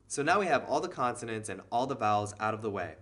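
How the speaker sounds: usual speaking voice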